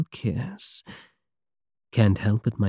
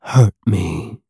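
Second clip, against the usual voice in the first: a firm, louder command; hypnotic command; 2 semitones lower, 4 dB louder than usual. Acted. Pirate hypnotizing his queen with a firm command. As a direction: give the order and let it sink in. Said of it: "command to react".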